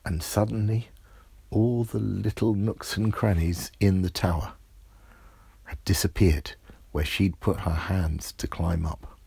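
ordinary delivery, nothing marked

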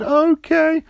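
put-on high, small child voice; little boy Roger. A narrator's reading. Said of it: "Roger said".

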